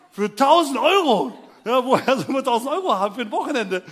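loud, high incredulous protest; mock outrage. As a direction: explode at the outrageous price. Outraged reply to the price. (laute, empörte Nachfrage)

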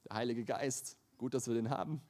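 ordinary talk

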